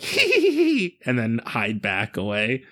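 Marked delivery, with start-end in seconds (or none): 0.0-1.0 high squeaky hee-hee; high squeaky monster giggles. Acted. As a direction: giggle like tiny mischievous monsters.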